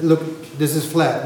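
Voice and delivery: usual speaking voice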